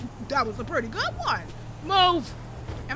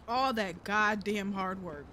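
ordinary talk, nothing marked

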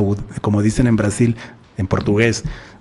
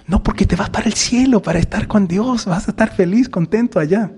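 put-on high, sweet child voice pleading with papa; be the innocent little daughter; little girl; 8 semitones higher than usual. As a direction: Saint Thérèse as a child asking her father, in a high sweet voice. Little girl explains heaven.

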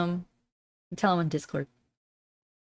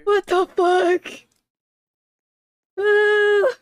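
high, loud outraged yell; shocked shout; 13 semitones higher, 7 dB louder, much slower than usual. Real, not acted. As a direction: cry out in disbelief at losing. Someone else got bingo.

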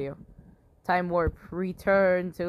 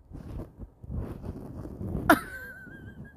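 high squeaky laugh. Real, not acted. Laughing at the monster.

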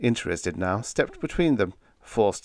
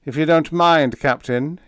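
louder, higher polite reminder; reminding; 8 semitones higher, 7 dB louder than usual. A narrator's reading. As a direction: prompt the captain with mock courtesy. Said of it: "he reminded him".

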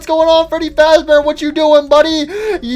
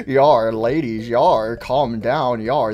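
suave pirate; 9 semitones lower, 4 dB quieter than usual. Acted. Pirate telling ladies to calm down, suave pirate. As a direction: croon it low, like a pirate charmer.

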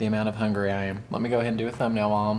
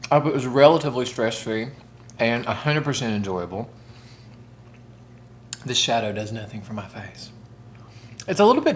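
muffled speech between bites; talking while chewing. Real, not acted. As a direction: mouth partly full of food. Seen 1: Forkful of rice eaten at -2; lips look full, chewing-like while talking.

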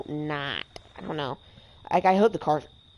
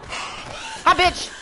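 taunting shout; 10 semitones higher than usual. Real, not acted. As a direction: jeer at the counselor you just caught. Taunting the killed counselor.

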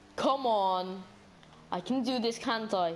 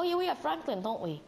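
ordinary talk, nothing marked

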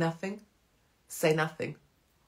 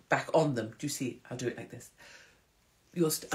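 ordinary delivery

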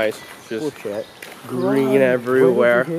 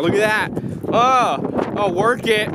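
hyped cheering; 9 semitones higher than usual. Real, not acted. Friend dances, cheering on.

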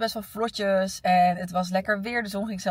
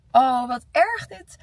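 dismayed; 5 semitones higher, 6 dB louder, slower than usual. Real, not acted. Groaning over exhausting day.

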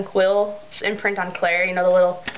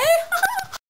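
short high-pitched giggle; high laugh. Real, not acted. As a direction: burst into a squeaky laugh. Laughs at own joke.

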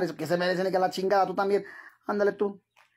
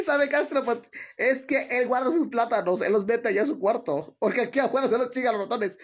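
ordinary talk, nothing marked

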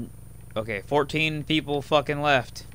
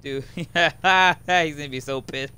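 laughing; 5 semitones higher, 7 dB louder, slower than usual. Real, not acted. Gloating over the kill.

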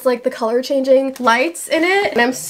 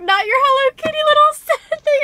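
high, lightly mocking girly voice; imitating the shop girls; 13 semitones higher than usual. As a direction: squeal it like the excited staff. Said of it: "they were like".

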